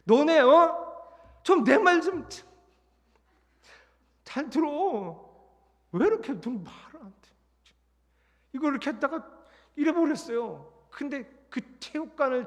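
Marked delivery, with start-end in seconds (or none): 0.0-7.3 high, loud, slow tearful yell; choked-up wail of frustration; tearful outburst. Retold or imitated. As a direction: break down shouting at them. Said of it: "서러워가지고 그 얘기를 하다가".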